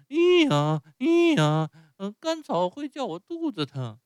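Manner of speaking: wail, then whine the excuse; pained wailing. Acted. Whipped Pinocchio wails again. (匹诺乔又痛又委屈地高声哭叫)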